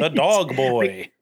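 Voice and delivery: slow, drawn-out barker call; hammed-up sideshow announcer. Carnival barker continues.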